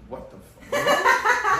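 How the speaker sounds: very high, drawn out laughing shock. Real, not acted. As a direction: shriek it in stunned laughter. Startled by sudden noise.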